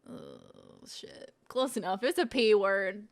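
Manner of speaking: ordinary delivery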